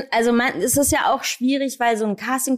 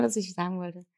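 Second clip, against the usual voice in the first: trailing off; 3 semitones lower, 11 dB quieter than usual. Real, not acted. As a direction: voice fades out, lost thread. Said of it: "jetzt hab ich den Faden verloren". (verwirrt leiser werdend)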